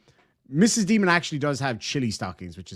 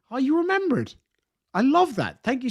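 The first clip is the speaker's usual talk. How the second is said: delighted surprise; 10 semitones higher than usual. Real, not acted. Viewer recalls old video.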